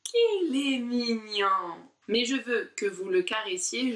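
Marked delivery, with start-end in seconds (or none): 0.0-1.8 slow, cooing qu'il est mignon; cooing at a kitten. Shown as a demonstration. Teacher cooing over an imaginary kitten. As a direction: coo over a cute cat. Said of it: "on caresse un petit chat tout mignon".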